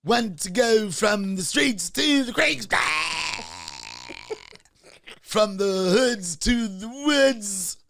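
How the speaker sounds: slow, loud ad announcer read; Bill Cosby advertising Donnell Land, slow and loud; mock promo pitch. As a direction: sell it like a cheesy commercial, word by word. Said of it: "Bill Cosby doing a promotion".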